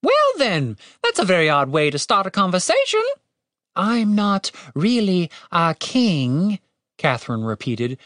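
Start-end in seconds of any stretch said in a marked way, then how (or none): 0.0-3.0 high-pitched bird voice; prim little bird, pitched up; miffed talking bird. A narrator's reading. Bird reacts to non-king. 3.7-6.6 put-on very slow, higher, careful British accent; tentative, drawn-out British accent; practising British accent. A narrator's reading. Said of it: "trying to get a fix on the accent".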